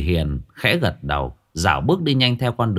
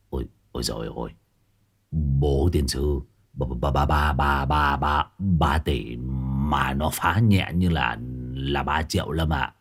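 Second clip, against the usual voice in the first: 1 stunned stammer; 8 semitones lower, 4 dB quieter than usual. A narrator's reading.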